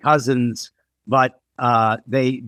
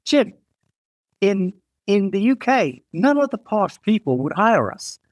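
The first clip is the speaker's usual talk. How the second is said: lightly voiced British woman, pitched higher; British designer; 7 semitones higher than usual. British designer explaining why posh people wouldn't hire them, lightly imitated. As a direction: retell her reply in a lightly raised voice. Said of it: "she's a Brit".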